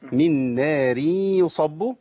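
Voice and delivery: من نار يصب with a thin راء, drawn out; slow demo; slow connected reading. Shown as a demonstration. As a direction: model the joined من نار يصب slowly. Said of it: "عند الوصل".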